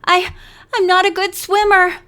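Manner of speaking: frightened gasping plea; desperate sputtering. A narrator's reading. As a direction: sputter it out in real panic. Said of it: "she sputtered desperately".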